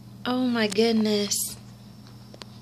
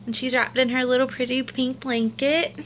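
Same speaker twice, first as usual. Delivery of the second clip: ordinary talk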